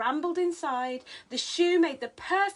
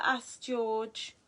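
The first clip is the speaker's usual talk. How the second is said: ordinary delivery, nothing marked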